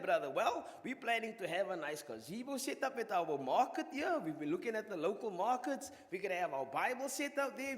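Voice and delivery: normal talking voice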